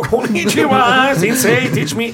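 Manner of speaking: high, slow, gushing anime fan; excited anime fan. Pretend. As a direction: overeager fan begging the sensei. Playing eager anime fan.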